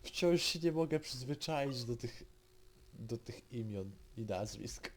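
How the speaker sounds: ordinary talk, nothing marked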